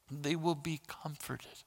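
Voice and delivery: pastor soothing grieving listeners, soft slow reassurance. Acted. Pastor repeating the promise softly.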